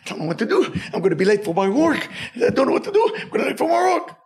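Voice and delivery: high, rushing, near tears on the repeat; panicked whining. Retold or imitated. Nervous man in the pharmacy line, panicked whining. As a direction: fret like you'll be late for work. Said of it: "he's very nervous, sweating".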